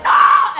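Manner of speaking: loud breathy fake scream; a trapped person screaming; mock scream. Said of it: "And you're like".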